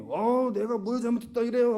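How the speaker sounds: high, loud student protest; indignant mock student outburst; defiant talking back. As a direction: talk back like a student hauled to the staff room. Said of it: "하고 대드는 거 봤습니까?".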